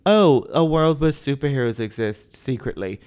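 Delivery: usual speaking voice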